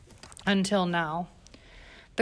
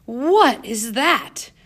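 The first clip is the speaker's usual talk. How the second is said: very slow, louder, higher horrified cry; shriek; 4 semitones higher, 6 dB louder, much slower than usual. A narrator's reading. Governess seeing the wailing girl, horrified shriek. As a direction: recoil at the sight of the girl. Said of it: "She let out a shriek".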